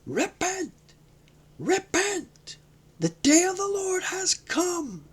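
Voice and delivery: high, ringing prophet's shout; the Baptist shouting at the multitude. A narrator's reading. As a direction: thunder it at the crowd like a wild prophet. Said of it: "the Baptist was shouting".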